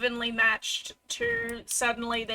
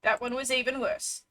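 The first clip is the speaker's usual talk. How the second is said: ordinary talk, nothing marked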